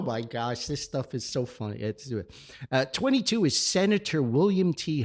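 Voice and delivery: ordinary delivery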